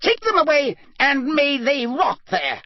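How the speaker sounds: enraged judge roaring; roaring curse. Acted. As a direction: curse them as they go. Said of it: "Crouch roared".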